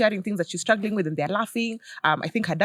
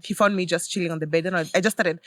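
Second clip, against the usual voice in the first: normal talking voice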